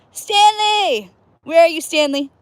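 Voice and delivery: playful call to someone in the background. Real, not acted. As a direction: call out loud and high across the water. Calling out to Stanley.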